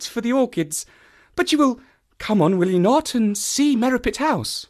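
high, light ladylike voice; Stapleton's sister, politely covering up. A narrator's reading. Woman excuses herself and invites the visitor, politely.

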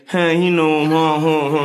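a hint of a put-on confident voice; lightly voiced fake swagger, stumbling; mock twang. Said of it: "couldn't stand in front of them and say".

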